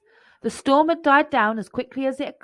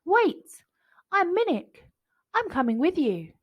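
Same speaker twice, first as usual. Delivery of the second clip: put-on child calling; 8 semitones higher than usual. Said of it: "he heard a voice behind him".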